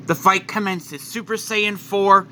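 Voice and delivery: ordinary delivery, nothing marked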